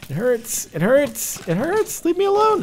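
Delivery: high, climbing yelps of distress; panicked whine. Real, not acted. Player being hurt by spiders, panicked rising whine.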